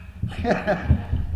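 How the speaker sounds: breathy, loud happy laugh; joyful laugh. Real, not acted. Father laughing with joy over his baby. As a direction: laugh out in delight. Laughs after thanking God.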